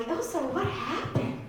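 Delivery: high, slow questioning mom voice; shocked mom. Acted. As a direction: stretch it out high and slow, like a concerned mother. Mom reacting to hickey in story.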